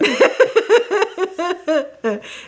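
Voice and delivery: high burst of laughter. Real, not acted. Laughs at "extra.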